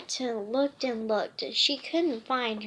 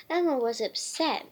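plain reading voice

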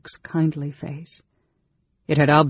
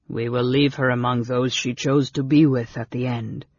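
solemn, slightly lowered voice; grave; 3 semitones lower than usual. A narrator's reading. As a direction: pronounce it gravely. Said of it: "his companion said gravely".

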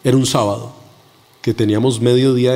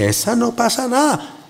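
mocking voice; 8 semitones higher than usual. Mocking people who say it.

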